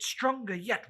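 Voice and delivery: ordinary delivery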